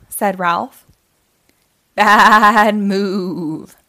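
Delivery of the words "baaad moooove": loud, wavering, drawn-out bleat; quavery sheep-and-cow chorus; farm animal bleat. A narrator's reading. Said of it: "said Sheila and Caroline together".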